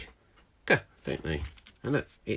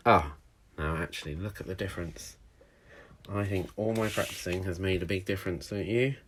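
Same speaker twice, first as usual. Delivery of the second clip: ordinary delivery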